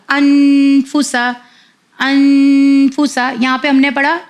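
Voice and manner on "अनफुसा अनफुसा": "अनफुसा" slowly, with a long hummed n; held nasal n. Shown as a demonstration. Teacher recalling her earlier reading, "अनफुसा" with drawn-out n. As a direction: stretch the न of "अनफुसा" before a short फुसा. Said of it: "मैंने इस तरह पढ़ा था".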